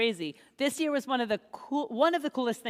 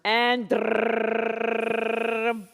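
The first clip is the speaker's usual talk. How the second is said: mock drumroll; 2 semitones lower than usual. Drumroll before the raffle winner.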